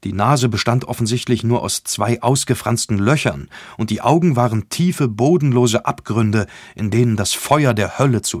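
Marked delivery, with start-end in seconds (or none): none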